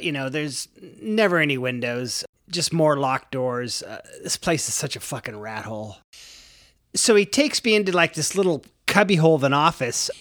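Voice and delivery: ordinary delivery, nothing marked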